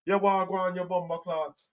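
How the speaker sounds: high, soft put-on Jamaican accent; mock Jamaican accent. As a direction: imitate a bad movie Jamaican accent. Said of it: "He talks like all the people on Cool Runnings".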